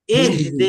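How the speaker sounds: "ইহদি" with h from the throat, not mid-throat; careful model "ইহদি", air flowing on h; correct throat h. Shown as a demonstration. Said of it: "একদম মাঝখানে যেন না যায়।".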